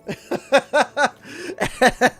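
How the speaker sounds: high, loud burst of laughter; real laugh. Real, not acted. Streamer cracks up after pulling out the 3DS, laughing. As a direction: crack up at your own story.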